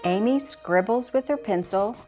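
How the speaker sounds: plain reading voice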